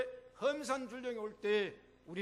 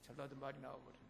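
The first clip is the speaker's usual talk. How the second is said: quiet aside; 6 semitones lower, 11 dB quieter, faster than usual. Real, not acted. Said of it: "self-aside after slip".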